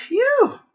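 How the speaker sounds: a high, loud relieved puff; loud high phew. Real, not acted. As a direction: blow it out like a big relief. Exaggerated exhale.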